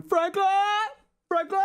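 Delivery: high calling shout out the van window; calling the dog. Retold or imitated. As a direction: call the lost dog by name. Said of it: "with my van windows down going".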